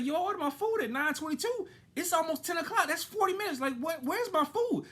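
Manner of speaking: high, pressing complaint, slowing at the end; indignant complaint. Retold or imitated. Customer confronting the teen server, high indignant complaint. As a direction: spell out the wait, incredulous.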